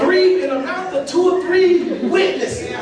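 high, loud, laughing through every word; real laughter. Real, not acted. Preacher laughing hard at the classmates calling him a preacher. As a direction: crack up while you tell it. Teller laughs retelling the girl's remark.

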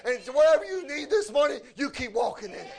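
punchy revival preaching; fervent preaching. Real, not acted. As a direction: preach it hard to an answering congregation. Preaches to the congregation.

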